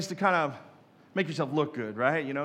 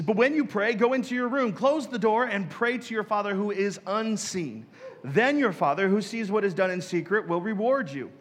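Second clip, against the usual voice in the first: raised, ringing reading of scripture; solemn Bible reading voice; 4 semitones higher than usual. Acted. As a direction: read the verse out like from the pulpit. Reads scripture aloud.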